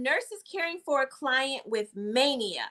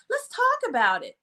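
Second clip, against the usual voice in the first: high gentle voice; 11 semitones higher than usual. Retold or imitated. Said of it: "I like that, Rose".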